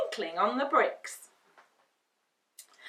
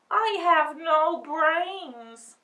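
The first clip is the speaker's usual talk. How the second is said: slow, mournful scarecrow confession; sad scarecrow; 7 semitones higher, 7 dB louder, much slower than usual. Acted. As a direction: drag it out, mildly forlorn. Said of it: "he added sadly".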